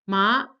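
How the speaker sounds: ma rising like a question; second tone ma. Shown as a demonstration. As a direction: lift ma upward as if asking. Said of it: "it sounds like this".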